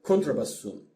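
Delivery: ordinary talk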